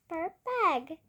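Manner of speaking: ordinary delivery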